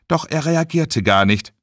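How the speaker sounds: ordinary delivery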